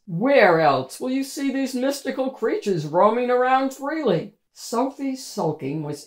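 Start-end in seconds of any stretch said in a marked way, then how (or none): 0.1-4.3 a hint of a cheery parent, a little louder; lightly voiced parent. A narrator's reading. Parent cheering up sulking Sophie, lightly voiced and upbeat. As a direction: coax a sulky child with mild enthusiasm.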